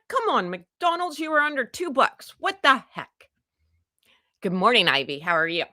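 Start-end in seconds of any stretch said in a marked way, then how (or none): 0.1-3.3 mock exasperation. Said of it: "I'm like".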